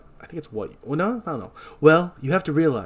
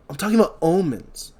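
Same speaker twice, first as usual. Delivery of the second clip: put-on higher, louder earnest young man; put-on character voice; 6 semitones higher, 5 dB louder than usual. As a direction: play Alex, pitched up and pushing. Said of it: "I'm trying to stop doing the voice".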